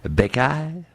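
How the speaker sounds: high, questioning try at a French accent; attempted French accent. Shown as a demonstration. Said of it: "say it with a French accent".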